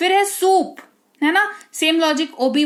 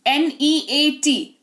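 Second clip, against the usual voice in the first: spelling out letters; 2 semitones higher, much slower than usual. Shown as a demonstration. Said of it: "यहाँ पर देखो N E A T".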